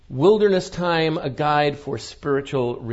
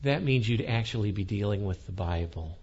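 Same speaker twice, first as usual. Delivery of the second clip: condescending smile; 6 semitones lower, 5 dB quieter than usual. Retold or imitated. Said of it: "with the most condescending smile".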